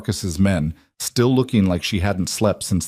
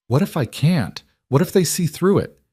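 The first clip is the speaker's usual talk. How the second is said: frightened; 8 semitones higher than usual. A narrator's reading. Frightened mother begging.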